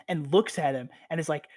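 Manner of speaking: ordinary talk, nothing marked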